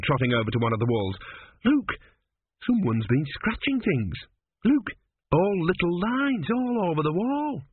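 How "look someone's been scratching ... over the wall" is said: high, drawn-out excited lad; young apprentice. A narrator's reading. Apprentice shows the king scratched walls, high and eager. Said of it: "said Gurn".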